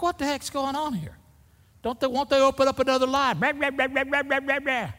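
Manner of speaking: high-pitched whiny grumbling, sent up; mock complaining. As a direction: complain in a squeaky, exaggerated whine that runs into babble. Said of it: "I complain".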